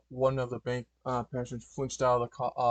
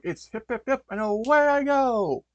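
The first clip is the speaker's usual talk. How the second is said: heroic take-off cry; 10 semitones higher, 8 dB louder than usual. Acted. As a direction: launch yourself with a rallying cry. Superhero dog's take-off catchphrase.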